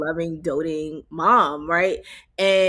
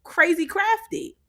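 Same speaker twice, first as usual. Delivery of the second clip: mock admiring; 8 semitones higher than usual. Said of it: "'cause it's like".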